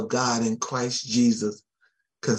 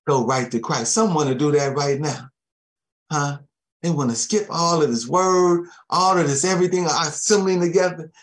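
normal talking voice

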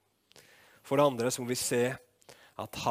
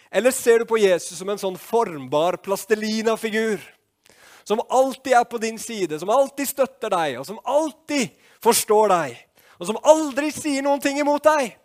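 loud, high, rising challenge to the congregation; forceful preaching; 10 semitones higher, 11 dB louder than usual. Acted. Preacher challenging the congregation about a mouldable Jesus, forceful preaching. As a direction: preach it hard, driving each phrase higher.

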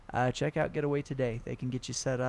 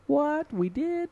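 mock surprise; 13 semitones higher, 6 dB louder, slower than usual. Mock surprise at guest plug.

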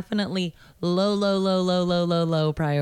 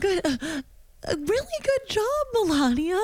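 high, sing-song mock praise; squeaky patronizing good job; mock Trump voice; 11 semitones higher than usual. Mocking Trump, high pitch.

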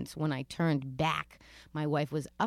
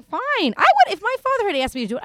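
excited rant; 14 semitones higher, 11 dB louder, faster than usual. Real, not acted. Excited rant about own father.

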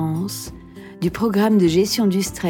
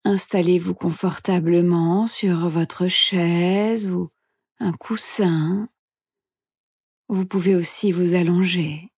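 soft, hushed meditation guide; guided meditation whisper; 5 dB quieter than usual. Acted. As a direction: whisper gently, leaving long pauses.

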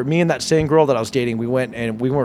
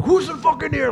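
high, mock-tough challenge; rescuing roommates; 13 semitones higher than usual. Storyteller voices roommates opening the door, high challenge. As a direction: imitate friends bursting in, pitched up. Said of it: "I hear them open the door".

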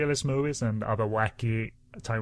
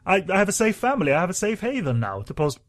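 mock eager member voice; 7 semitones higher, 4 dB louder than usual.

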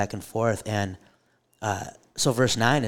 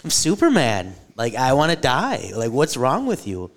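loud, higher exasperated rant; angry Jonah; 4 semitones higher, 7 dB louder than usual. Acted. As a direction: vent at God like a fed-up teenager. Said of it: "And Jonah's like".

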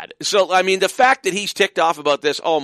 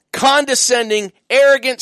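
usual speaking voice

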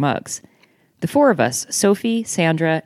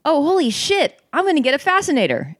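high-pitched thrilled outburst; excited; 12 semitones higher than usual. A narrator's reading. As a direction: burst out with delight, voice leaping up. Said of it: "waves her hands around in excitement".

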